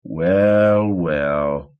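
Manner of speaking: sneering at the dead man; smug repeat. A narrator's reading. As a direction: repeat it, even smugger. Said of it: "he repeated".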